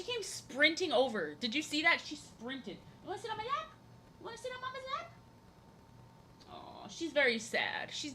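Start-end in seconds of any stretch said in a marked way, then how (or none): 3.0-5.1 much higher, softer cooing question. Real, not acted. Streamer inviting her cat onto her lap, high soft cooing.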